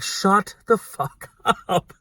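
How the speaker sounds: slow, high mock order breaking into laughter; laughing retelling. Husband to his wife, retold with a laugh. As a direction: imitate the exasperated husband while cracking up.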